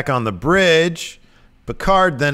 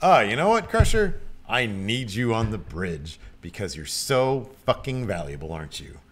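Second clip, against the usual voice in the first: mock-sweet patronizing praise, trailing softer; condescending; 3 dB quieter than usual. Picard to Crusher about needing her, condescending. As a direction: talk down as if to a child. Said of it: "he says it in the most condescending manner".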